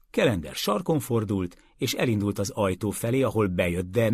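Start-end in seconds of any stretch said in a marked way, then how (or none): none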